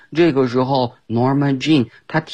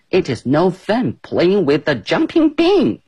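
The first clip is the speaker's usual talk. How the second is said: annoyed Amy; 8 semitones higher than usual. Acted. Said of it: "Amy生气了".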